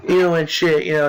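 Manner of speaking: ordinary talk, nothing marked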